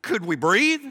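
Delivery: high, loud incredulous question; mock disbelief. Preacher voices stunned people reacting to God's order, mock incredulous. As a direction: swoop up as if begging for a pause.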